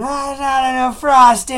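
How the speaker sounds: put-on high, loud toddler wail; imitated tantrum, words smeared by crying; mock crying. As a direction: bawl it like an overtired kid. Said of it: "he starts crying and going".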